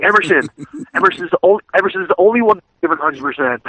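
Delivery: high, laughing imitation of the coach; a lightly voiced coach singling him out; mock coach praise. Coach praises Emerson to the team, mock voice. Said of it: "he's like".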